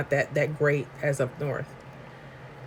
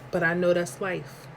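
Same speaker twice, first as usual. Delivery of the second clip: ordinary delivery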